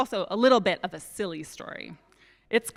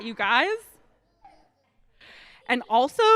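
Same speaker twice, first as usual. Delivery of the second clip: usual speaking voice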